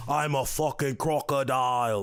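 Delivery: put-on slow, drawn-out Australian accent. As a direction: lay on a broad Australian accent. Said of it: "doing, like, uh, Australian accents".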